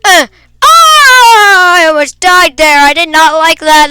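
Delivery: just escaped death, cry out shaken; scared yelp. Real, not acted. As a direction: gasp out relief after nearly dying.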